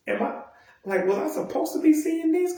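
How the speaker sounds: high-pitched mock bewilderment; incredulous. Viewer doubting she should see this, incredulous. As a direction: squeak it out as a baffled viewer.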